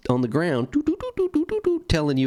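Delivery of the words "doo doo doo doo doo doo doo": high sung doo doo. Retold or imitated. Mimicking a guiding game jingle.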